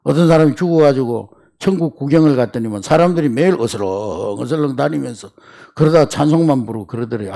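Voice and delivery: ordinary delivery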